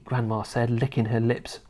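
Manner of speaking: ordinary delivery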